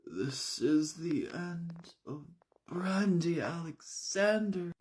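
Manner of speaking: high, slow theatrical lament; dying drama queen. Acted. Brandy bleeding out at the bottom of the steps, melodramatic dying lament. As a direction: draw it out like a hammed-up death scene. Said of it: "she's also like".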